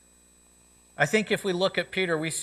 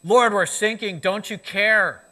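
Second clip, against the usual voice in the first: louder, raised desperate cry, slowing on care; 4 semitones higher, 6 dB louder than usual. Retold or imitated. Peter crying out to Jesus in the storm, a loud plea. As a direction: call out over the storm for help. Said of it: "I can picture Peter crying out".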